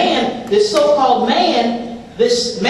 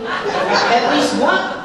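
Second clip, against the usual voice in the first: drawn-out aside; much slower than usual. Real, not acted. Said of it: "comic aside timing".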